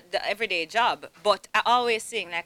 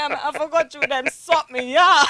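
laughing complaint; 5 semitones higher, 12 dB louder than usual. Retold or imitated. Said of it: "I said".

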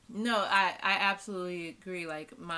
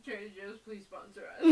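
laughing plea; 3 semitones higher, 11 dB louder than usual. Real, not acted.

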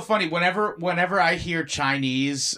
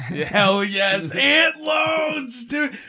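excited shout; 9 semitones higher, 4 dB louder, much slower than usual. Real, not acted. Cheering a joke.

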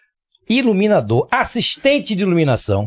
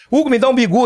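higher mock voice of a local; local asking a lift; 7 semitones higher, faster than usual. Retelling a local asking him for a ride, higher mock voice. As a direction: call out a casual favour, pitched up. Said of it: "o cara chegou".

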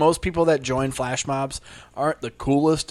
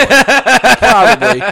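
mock drawling probably; a goofy slow high drawl; slow high drawn-out probably; 8 semitones higher, 11 dB louder, much slower than usual.

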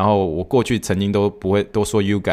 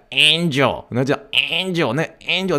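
angel with a heavy Texan twang; Texan accent; 6 semitones higher than usual. Shown as a demonstration. Showing the choir's Texan angel, in a Texan twang. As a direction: lean hard into the Texan angel. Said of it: "德州腔都是这样angel".

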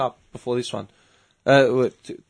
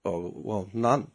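sheepish mate; 7 semitones lower, 8 dB quieter, much slower than usual. Retold or imitated. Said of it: "How many soccer games".

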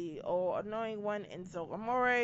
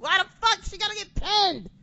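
high, loud outraged yell; indignant shout at the screen; 11 semitones higher, 8 dB louder than usual. Retold or imitated. Fan yelling at TV when Naomi is pinned, outraged. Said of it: "I'm just yelling at the T V".

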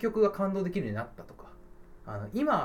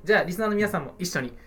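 ordinary talk

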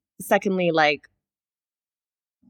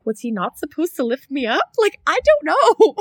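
high, baffled and a bit lost, slowing at the end; confused squeak; 9 semitones higher, 4 dB louder than usual. Retold or imitated. To herself after the facilitator intervenes, high and baffled. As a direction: play the innocent who has no idea what went wrong.

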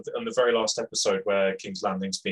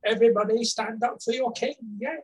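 very high mock herald voice; unauthoritative announcement. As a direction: squeak out a feeble call to the crowd. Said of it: "you are not authoritative at all".

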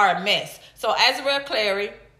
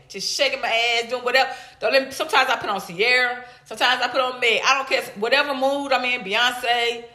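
usual speaking voice

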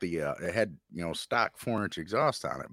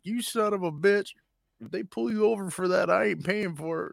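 much higher, louder mock scolding; high mock scolding boss; 12 semitones higher, 4 dB louder than usual. Driver's boss scolding him over the noise, high mock anger. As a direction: play the angry boss up high and loud. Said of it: "And he's like".

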